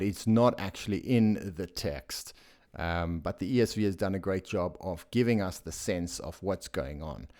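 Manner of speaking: ordinary talk, nothing marked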